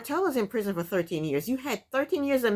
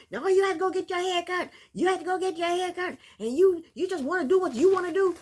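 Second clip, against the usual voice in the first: higher, mocking nagging voice; mocking Kayla's rant; 10 semitones higher than usual. Vlogger mimicking Kayla yelling at Martell, mocking and higher. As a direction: parody her shrill going-off. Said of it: "she's going off about".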